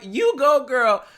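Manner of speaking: high, loud quoted cheer; loud, playful repeat of a catchphrase; mock cheer. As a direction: toss it out as a hammed-up hurrah. Repeats the comment, amused.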